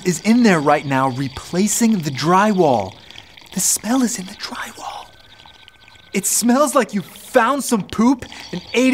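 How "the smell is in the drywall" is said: hushed dramatic whisper. Acted. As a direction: whisper it like a secret.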